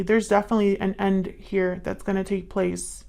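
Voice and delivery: usual speaking voice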